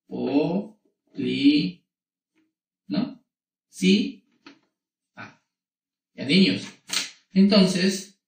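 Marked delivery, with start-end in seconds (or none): 0.1-1.8 "O, li" as separate, drawn-out syllables. Shown as a demonstration. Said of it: "la separación va a ser así". 3.7-5.4 "Si" high and held, then "a" apart after a long pause; syllable by syllable. Shown as a demonstration. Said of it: "la separación va a ser así".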